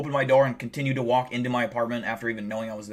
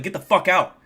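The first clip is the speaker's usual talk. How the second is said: retold shout; 6 semitones higher, 6 dB louder than usual. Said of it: "I was like".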